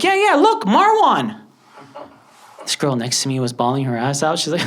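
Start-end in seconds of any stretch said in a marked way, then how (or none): 0.0-1.4 very high, loud eager cry; squealing with delight at his name on screen; excited pointing. Retold or imitated. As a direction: show them, bursting with pride.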